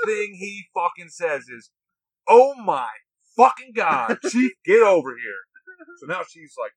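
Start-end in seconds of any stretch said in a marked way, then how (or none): 2.3-5.4 loud, high, horrified outburst; shocked shout. Retold or imitated. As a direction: yell across the room in disbelief. Inspector shocked at recruit's face.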